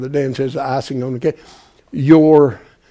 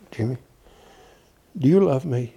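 normal talking voice

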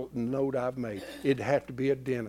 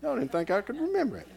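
quick laughing aside, higher; 10 semitones higher, faster than usual. Real, not acted. Preacher laughing at himself to the congregation, amused. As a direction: tease them through a laugh.

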